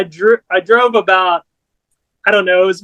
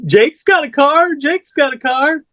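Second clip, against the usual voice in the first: much higher, eager mock-excited pitch; eager offer; 8 semitones higher than usual. Buddy eagerly offering the band a ride, excited and high. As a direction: blurt it out like an eager fan offering help.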